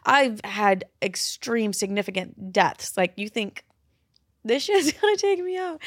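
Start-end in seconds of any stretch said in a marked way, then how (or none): 4.4-5.8 mock worried question. Mock quote of worried listener.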